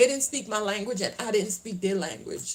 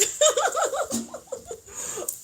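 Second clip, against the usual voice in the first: high-pitched giggling laugh; real amused laugh. Real, not acted. Tells viewers Raymond likes her accent, real high laugh. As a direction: burst into a long, high cackle of amusement.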